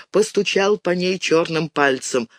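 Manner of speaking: ordinary delivery, nothing marked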